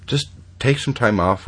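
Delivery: ordinary talk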